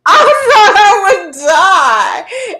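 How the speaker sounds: high, loud, slow words through laughter; gasping with laughter; laughing. Real, not acted. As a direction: barely get it out for laughing. Laughing hard at the memory.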